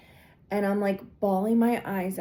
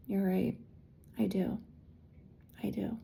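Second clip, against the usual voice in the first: quiet acceptance; 9 dB quieter than usual. Retold or imitated. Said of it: "And I was like".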